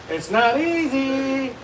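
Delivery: high, loud, very drawn-out cry; exaggerated wail. Comic on how hard stand-up is, exaggerated wail. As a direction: stretch it out in mock distress. Exaggerated repeat of own remark.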